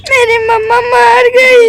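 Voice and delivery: slow, wailing cry that mummy died. Acted. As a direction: wail it as a child in tears. Child thinks mother died. (रोते हुए चीखना)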